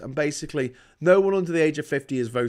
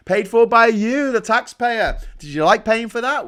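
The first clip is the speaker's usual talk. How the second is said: "by" higher and louder, pitch up; louder rising demand; 7 semitones higher, 7 dB louder than usual. Real, not acted. Ranting vlogger confronts taxpayer viewers, voice raised.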